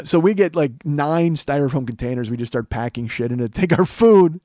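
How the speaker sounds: usual speaking voice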